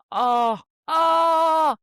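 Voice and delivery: drawn-out swooning groan, building in pitch and volume; ecstatic moan. Acted. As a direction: moan with exaggerated delight over delicious food. Raving about melted cheese chips.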